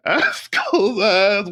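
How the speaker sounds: slow, high, laugh-choked words. Real, not acted. Podcast guest confessing how the scare ended, laughing. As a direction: squeeze it out through real laughter. Laughing while telling the ending.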